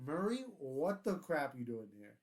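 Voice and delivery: high, loud, slow mock outrage at the crowd; incredulous mock disbelief. Reviewer mocking the finale guests, incredulous and high-pitched. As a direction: act baffled, drawing out each name in disbelief. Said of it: "I'm like".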